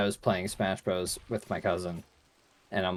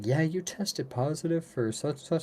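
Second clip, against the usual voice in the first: high, put-on caller voice; caller's voice; 8 semitones higher than usual. Clinic caller phoning with test results, high put-on voice. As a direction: imitate the caller in a raised pitch. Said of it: "I get a call saying".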